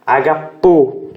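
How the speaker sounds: Agapo said slowly; slow teaching model of Agapo; slow model word. Shown as a demonstration. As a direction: draw out Agapo. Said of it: "pra mostrar pra vocês".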